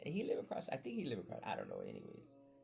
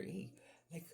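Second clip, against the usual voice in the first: usual speaking voice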